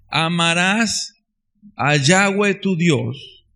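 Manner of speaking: slow, drawn-out solemn recitation; slow, reverent reading of the verse; slow scripture reading. Acted. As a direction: lay out each phrase of the verse deliberately. Rereading scripture slowly.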